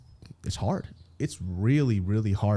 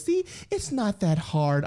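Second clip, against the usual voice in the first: slow, higher teasing partner voice; mock fiancee; 8 semitones higher, slower than usual. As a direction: tease him gently, slowly. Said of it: "she goes".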